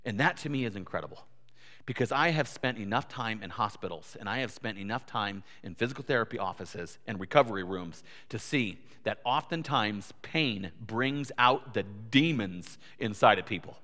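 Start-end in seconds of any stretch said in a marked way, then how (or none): none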